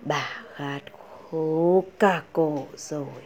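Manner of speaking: a faint, parched grandmother asking for water; sick grandmother calling. A narrator's reading. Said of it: "Bà gọi".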